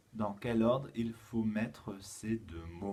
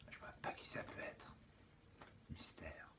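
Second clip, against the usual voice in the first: playful whisper of mystery; hushed playful puzzlement; mysterious whisper; 6 semitones lower, 17 dB quieter than usual. Acted. Mock mystery over a note.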